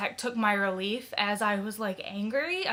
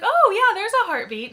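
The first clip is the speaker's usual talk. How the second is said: high, loud imitation of an upbeat tech; bright retold reassurance; 11 semitones higher, 10 dB louder than usual. Ultrasound tech reassuring the waiting parents, high and emphatic. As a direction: imitate a cheery, emphatic confirmation. Retells tech's reassurance of heartbeat.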